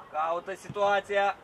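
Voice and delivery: loud, slow drawn-out instruction; coaching shout. Real, not acted. Coach instructing a player, slow loud call. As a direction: stretch the order out across the field.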